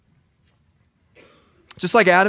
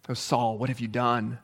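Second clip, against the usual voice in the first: soft, grieved, much quieter rebuke; a quiet, heartbroken "what have you done"; hushed rebuke; 8 semitones lower, 11 dB quieter than usual. Acted. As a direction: sigh it out like a sorrowful prophet. Samuel rebuking Saul, voiced.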